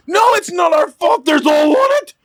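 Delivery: high-pitched hammed-up yell; mock dad-voice shout. Coworker mimicking boss's dad voice on a client call, shouting. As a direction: imitate a boss yelling at a client. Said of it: "I just put on the dad voice".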